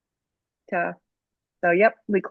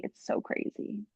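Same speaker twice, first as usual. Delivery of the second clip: whispered; 14 dB quieter than usual. Real, not acted. Unvoiced aside.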